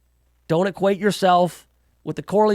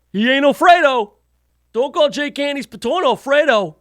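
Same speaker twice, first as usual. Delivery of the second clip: higher, louder hammed-up Italian-American mobster accent; mock Italian tough guy; 7 semitones higher, 4 dB louder than usual. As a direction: warn them off like a wiseguy, playing it up.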